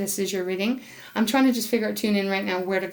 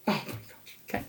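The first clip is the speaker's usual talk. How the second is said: breathless whisper of wonder; awed whisper; 4 semitones lower, 10 dB quieter than usual. Real, not acted. Reader whispers in awe at the card. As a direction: hush it out, amazed.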